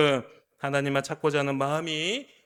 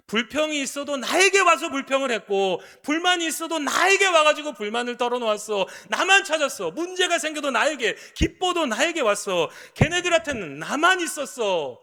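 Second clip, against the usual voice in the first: higher and louder fervent declaration; impassioned God; 10 semitones higher, 8 dB louder than usual. Acted. God recalling his children came only to him, loud and high. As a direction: proclaim it with longing intensity.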